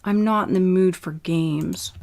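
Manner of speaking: plain reading voice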